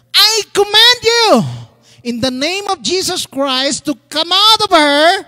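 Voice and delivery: loud, high commanding shout; exasperated command. A narrator's reading. Paul commanding the demon within her, exasperated shout. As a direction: order a demon out with full force. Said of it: "Paul got so exasperated".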